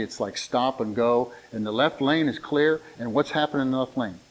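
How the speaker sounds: ordinary talk, nothing marked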